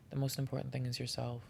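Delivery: ordinary delivery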